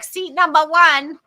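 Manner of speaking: judge's announcement. Retold or imitated. Judge assigning a jury seat, mock announcement. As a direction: call it out like a judge. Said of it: "the judge was like".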